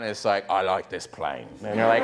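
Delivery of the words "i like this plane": low British mumble; mumbled British accent. Acted. British film gangster on a plane, mumbled British accent. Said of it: "full-on British mumble mode".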